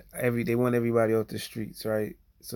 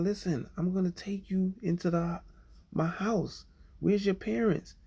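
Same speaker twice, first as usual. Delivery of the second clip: raised, gentle questioning voice; coaxing a child; 8 semitones higher than usual. Retold or imitated. Rescuer to a lost child, coaxing and high-pitched. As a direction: talk the scared kid into coming inside.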